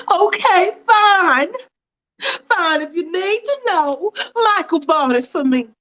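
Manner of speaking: wife caught out, snapping back defensively. Acted. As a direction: give in with a sharp, defensive edge.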